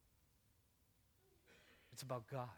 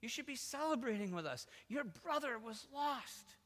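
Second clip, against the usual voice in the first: higher, gentle pleading; gracious, loving father; 7 semitones higher, slower than usual. Acted. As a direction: coax the hurt son tenderly. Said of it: "The gracious father, the loving father".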